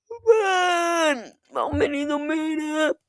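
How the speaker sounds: high, drawn-out wail falling on the first word; exaggerated moan of tiredness; cringey tired whine. Real, not acted. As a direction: whine it out on a high held pitch. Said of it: "wie hat sich grad cringe angehört".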